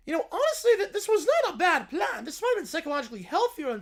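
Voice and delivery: villain voice looking back on the plan; Doofenshmirtz voice. Acted. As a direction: concede it thoughtfully in character. Villain reflects, still high.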